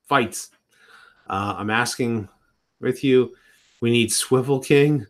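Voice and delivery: usual speaking voice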